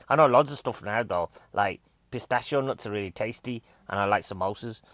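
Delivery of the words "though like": normal talking voice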